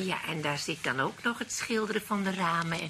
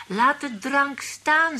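mimicking the doctor; 7 semitones higher, 6 dB louder, much slower than usual. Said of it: "zeiden ze dan tegen hem".

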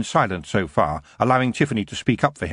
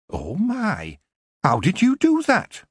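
amazed; 11 semitones higher, slower than usual. A narrator's reading. Dwarf amazed his pain gone.

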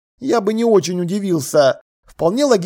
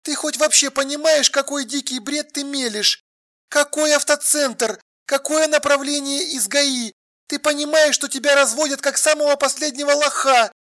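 high, indignant woman, not shouting; exasperated woman on the phone; 7 semitones higher, 4 dB quieter than usual. A narrator's reading. Alla telling Sasha he is being swindled, exasperated. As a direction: scold him in a higher, exasperated voice.

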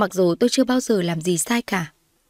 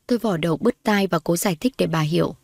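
ordinary delivery

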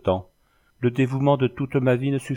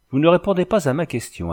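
higher-pitched, mildly imploring reproach; pleading; 7 semitones higher, 4 dB louder than usual. A narrator's reading. Girl begging the carter to answer her question, pleading. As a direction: beg for an answer, pitch lifted. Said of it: "d'un ton suppliant".

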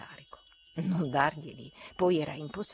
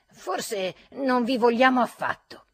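slow, louder and higher, biting each word; angry retort; 6 semitones higher, 8 dB louder than usual. A narrator's reading. Ferfitkin snubbing the narrator, slow and angry. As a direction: spit it out with contempt. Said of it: "disse con rabbia Ferfitkin".

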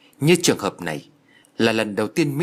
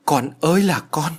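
higher, acted lament of a mother; narrated motherly anguish, raised voice; distraught wail; 6 semitones higher, 4 dB louder than usual. A narrator's reading. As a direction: wail for your child, pitched up. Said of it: "Bà giáo nhân thất thần".

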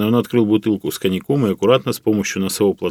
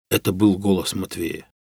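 ordinary delivery, nothing marked

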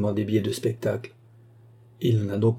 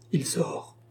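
soft, high murmur; 6 semitones higher, 6 dB quieter than usual. A narrator's reading. Baccarat vexed that Fernand is leaving, murmured. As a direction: murmur it under narrated disappointment. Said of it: "murmura Baccarat".

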